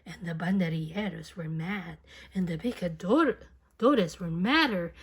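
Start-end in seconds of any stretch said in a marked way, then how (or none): none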